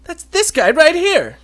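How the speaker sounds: high, loud, drawn-out mock astonishment; acted sudden realization. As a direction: play the officer catching the culprit. Acts officer realizing who called.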